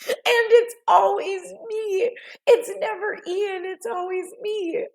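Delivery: high, squeaky laugh-cry; laughing lament. Real, not acted. Owning up to always being the one, laughing high. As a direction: wail it through helpless laughter. Laughing at own repeated mishaps.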